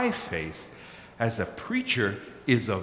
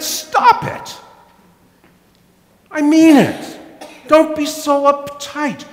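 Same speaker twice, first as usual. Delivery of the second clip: much higher, louder mock scolding wife; 6 dB louder than usual. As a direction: play the wife telling him off, high and insistent. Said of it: "And Irene said".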